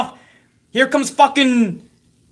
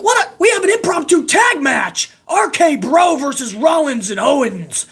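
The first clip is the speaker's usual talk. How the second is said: high, shouted hype call; mock excited announcer; 5 semitones higher than usual. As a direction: oversell a surprise tag match, pitched up. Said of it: "He's like".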